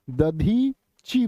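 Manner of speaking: Dadhichi drawn out in parts, "dadhee... chi"; slow sounding-out. Shown as a demonstration. Teacher giving students the correct form, Dadhichi slowly broken up. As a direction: stretch Dadhichi slowly, holding the long ee. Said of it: "तो क्या बन जाएगा".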